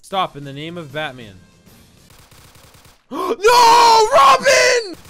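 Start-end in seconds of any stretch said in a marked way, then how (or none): 3.1-5.0 high, loud mock wail; hammed-up despairing yell; anguished shout. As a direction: cry out as the sidekick is caught. Sidekick captured in Batman play.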